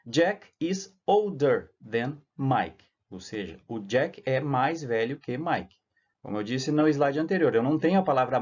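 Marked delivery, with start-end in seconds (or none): 0.0-2.9 English sentence said slowly and clearly; slow model sentence. Shown as a demonstration. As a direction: lees "Jack is older than Mike" langzaam en duidelijk voor. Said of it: "ó".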